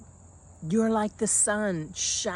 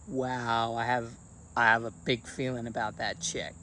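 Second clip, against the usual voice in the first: rattled onlooker; 7 semitones lower than usual. Retold or imitated. Voicing a rattled person.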